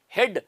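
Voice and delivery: हेड with a short e in the middle; demonstrated pronunciation. Shown as a demonstration. Teacher shows how हेड is read, demonstrated pronunciation. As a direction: model हेड as one syllable with short e. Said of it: "ऐसे पढ़ते हैं उसको".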